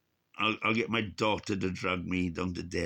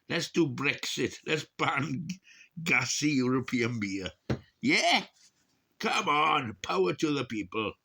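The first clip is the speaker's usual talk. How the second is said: higher, louder rabble-rousing; mock rally cry; 7 semitones higher, 4 dB louder than usual. Vlogger mock-campaigns to ban gassy beer, rally style. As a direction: rouse an imaginary crowd.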